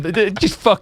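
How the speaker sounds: ordinary talk, nothing marked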